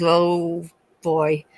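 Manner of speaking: normal talking voice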